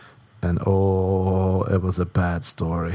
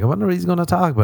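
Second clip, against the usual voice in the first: higher, rapid inner thought; imagined date; 8 semitones higher, much faster than usual. Acted. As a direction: play a hopeful date wondering, quick and high. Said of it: "she's like".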